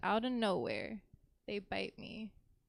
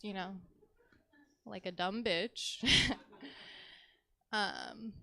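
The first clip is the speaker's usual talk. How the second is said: ordinary talk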